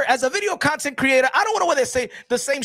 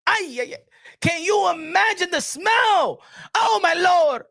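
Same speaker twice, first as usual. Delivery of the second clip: exclaiming; 6 semitones higher, slower than usual. Acted. Disgust at imagined smell.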